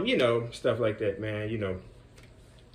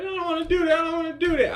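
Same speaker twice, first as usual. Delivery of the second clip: put-on high whiny child voice, louder; sulky kid; 5 dB louder, faster than usual. Stubborn boy refusing the coach, high whiny kid voice. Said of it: "He was like".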